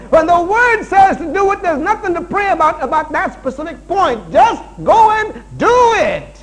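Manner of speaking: loud, high, climactic exhortation; full-force preaching. Real, not acted. Preacher telling husbands to stop stalling, full-force preaching. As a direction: build to a slow, shouted final order.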